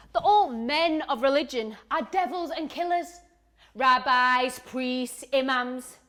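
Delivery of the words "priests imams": ordinary delivery, nothing marked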